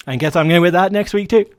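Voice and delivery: real hyper excitement. Real, not acted. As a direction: burst out with giddy energy. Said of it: "I'm in a really hyper mood".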